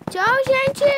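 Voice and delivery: loud high goodbye. Real, not acted. Loud goodbye after plain one.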